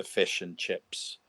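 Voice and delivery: New Zealand accent, "fish and chips" as "fesh and cheps"; mimicked Kiwi "fish and chips" with shifted short i's; Kiwi vowels. Shown as a demonstration. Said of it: "they say particular vowels".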